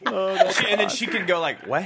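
high, loud, laughing excitement; excited squeal. Real, not acted. Delighted squeal while pitching a joke. Excited joking about Vanessa's reaction.

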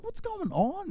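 panicked squeak of a worried dad; high-pitched what's-going-on; alarmed. Retold or imitated. Hearing of violent kid.

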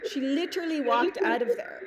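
ordinary talk

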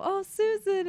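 high, loud wail of the name; mock kids wailing. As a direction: cry out the name like a grieving child. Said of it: "the kids are, you know".

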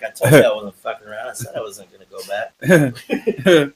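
少し大きめの声で凄む真似; ゆっくり軽く凄んでみせる; mock threatening. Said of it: "It's more like".

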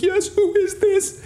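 put-on very high, slow old-lady voice; elderly lady. Elderly lady questioning the caller, high and drawn out. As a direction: mimic a wary old woman. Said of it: "this elderly lady w-, answered the phone".

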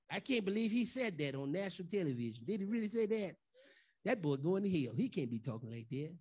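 a hint of a shocked churchgoer; judgmental churchgoer. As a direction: murmur it like a disapproving church member. Acting out scandalized church members.

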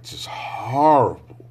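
ordinary delivery

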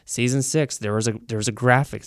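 usual speaking voice

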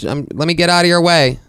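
fake-nice to a stranger; mock polite apology; overly polite. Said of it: "And I go".

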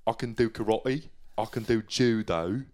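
a slow parody of the Taken speech; Liam Neeson impression. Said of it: "It's more like this".

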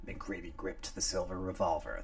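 ordinary delivery, nothing marked

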